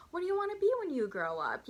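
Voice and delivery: put-on high, sing-song question to a child; sweet child-directed talk. Waitress asks the little girl, sweetly. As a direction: imitate a waitress cooing at a little customer.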